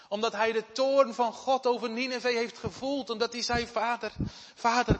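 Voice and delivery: loud, high, urgent preaching; fervent preaching. Acted. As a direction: preach it out to the congregation. Preaching at full force. (luid en vurig preken)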